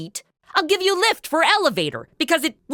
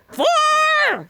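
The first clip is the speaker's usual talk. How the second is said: shouted high across the room; sudden high yell of Four; golf warning shout; 13 semitones higher, 3 dB louder than usual. Acted.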